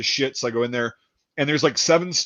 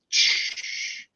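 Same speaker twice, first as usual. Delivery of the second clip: short breathy shushing noise; imitated hiss; 6 dB quieter than usual. Storyteller at the sink beside the janitor, hissing. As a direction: let out a soft voiceless shh.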